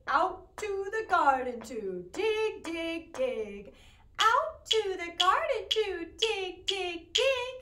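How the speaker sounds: a lightly voiced chanting pig. Teacher voicing the pig for the children, chanted. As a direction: chant it like a busy little pig. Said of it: "when I was talking like the pig".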